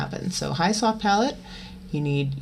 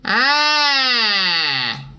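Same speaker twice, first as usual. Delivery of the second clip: long, loud, higher pinched ah gliding down; angry cat exercise vowel; twangy ah; 5 semitones higher, 5 dB louder than usual. Shown as a demonstration. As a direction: belt a twangy ah, letting it fall. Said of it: "cool breath, neutral mouth, tongue forward".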